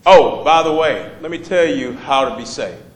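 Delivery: usual speaking voice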